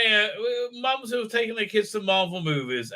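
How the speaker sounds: usual speaking voice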